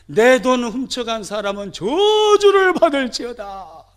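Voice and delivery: loud, high public curse on the thief; proclaiming a curse. Retold or imitated. Mother crying a curse through the streets, loud and high. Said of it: "거리거리 다니면서 선포하고 다닙니다".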